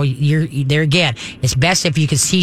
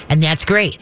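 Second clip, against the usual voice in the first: ordinary talk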